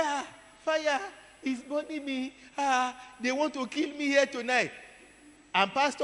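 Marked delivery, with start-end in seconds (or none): 0.0-4.7 much higher, frightened cry about burning; terrified plea. Retold or imitated. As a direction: plead in terror, pitched high. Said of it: "She said".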